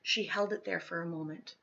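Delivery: usual speaking voice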